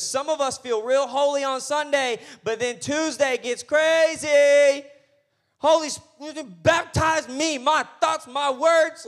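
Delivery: loud, high pleading cry; preacher crying out to the Holy Spirit; rising shout. Acted. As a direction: build to a full-voiced plea.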